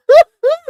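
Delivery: high loud laugh bursting out; a quick high bright laugh; burst of laughter. Real, not acted. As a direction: crack up suddenly. Laughs mid sentence.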